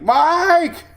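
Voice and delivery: high, loud excited shout of a name; excited fan. Retold or imitated. British fan recognizing the weatherman, excited shout. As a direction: yell the name like a thrilled fan spotting you. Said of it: "He's like".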